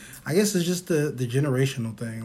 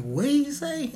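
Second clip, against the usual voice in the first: hint of a confused mother, higher; imitating mom; 11 semitones higher than usual. Son imitating mom asking after the doctor, higher. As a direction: ask it like a puzzled mom. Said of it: "She be like".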